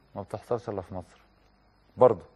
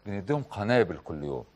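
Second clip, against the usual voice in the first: ordinary talk, nothing marked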